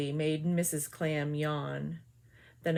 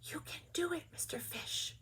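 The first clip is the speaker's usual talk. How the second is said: hushed encouraging whisper; tender whispered cheer; sweet soft voice; 8 semitones higher, 12 dB quieter than usual. A narrator's reading. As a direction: coax him gently. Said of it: "her sweet voice said".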